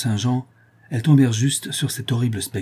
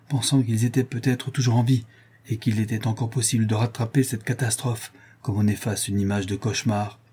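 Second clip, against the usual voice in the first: usual speaking voice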